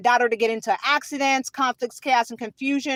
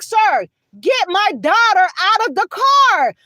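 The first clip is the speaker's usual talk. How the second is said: higher, slow, insistent appeal; retold emphatic demand; 5 semitones higher, 4 dB louder than usual. Mother to officer from police car, retold emphatic demand. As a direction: re-enact it slowly, leaning on each word.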